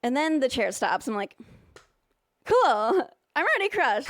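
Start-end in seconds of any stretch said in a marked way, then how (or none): 2.4-4.1 sarcastic. Retold or imitated. Sarcastic reply after crush.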